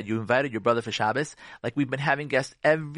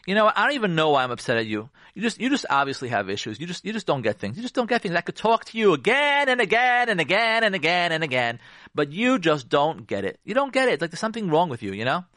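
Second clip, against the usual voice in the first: exasperated, condescending put-down; belittling; 3 semitones higher than usual. Acted. Upset spouse belittling the husband, exasperated. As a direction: talk down to them, pitch leaping on the complaints. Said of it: "That's belittling".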